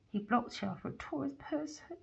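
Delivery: hushed secret whisper. Real, not acted. Tarot reader telling the viewer, whispered. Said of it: "whispered reading".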